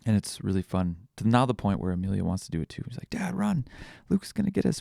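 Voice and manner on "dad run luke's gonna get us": put-on high-pitched kid's urgent warning; imitated excited child. Child warns dad in a chase game, high mock urgency.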